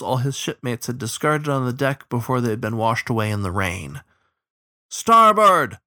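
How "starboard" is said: high, full-voiced bellow; shouted command. A narrator's reading. Captain calling to his rowers in the storm, shouted. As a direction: shout the order down the boat. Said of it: "shouted the captain".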